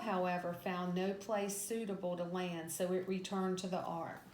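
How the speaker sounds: usual speaking voice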